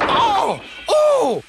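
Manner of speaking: loud, very high yelps; cried out in pain. A narrator's reading. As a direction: yelp as if just bumped hard. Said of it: "cried the van".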